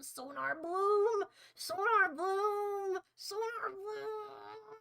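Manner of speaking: very slow, rising, fading to a breathy cry; ominous roll call. Acted. Streamer slowly cursing the band's name. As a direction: drag the name out like a curse.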